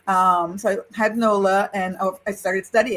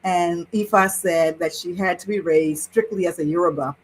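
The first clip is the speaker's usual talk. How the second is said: ordinary talk, nothing marked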